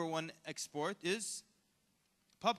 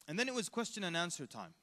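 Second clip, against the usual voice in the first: ordinary talk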